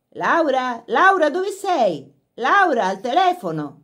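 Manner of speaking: loud, high calling; calling from the house. A narrator's reading. A voice from the house calling Laura, loud call. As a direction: call her name across the house. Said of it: "gridò una voce dalla casa".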